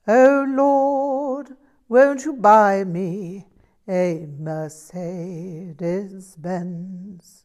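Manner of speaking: slow, loud, sung caricature of a posh English voice; Prince Charles impression. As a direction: ham up a royal singing the blues, dragging it out. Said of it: "I gave it my best shot with".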